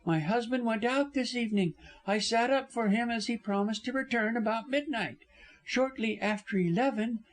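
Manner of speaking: high-pitched woman giving her account; high, earnest female character; distraught young wife. Acted. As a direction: recount it steadily in a high voice. Narrator plays the wife recounting.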